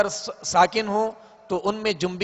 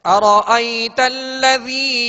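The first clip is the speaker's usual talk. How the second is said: 1 recited verse; 4 semitones higher, 4 dB louder than usual. Shown as a demonstration. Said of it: "teacher recites verse".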